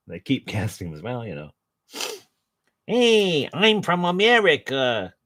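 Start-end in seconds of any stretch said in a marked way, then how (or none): 2.9-5.1 much higher, hammed-up bad American accent; mock a terrible American accent, slowing on the end; Ray Scott's American accent. Mocking an actor's terrible American accent on screen, high hammy American accent. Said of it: "Ray Scott does a terrible American accent".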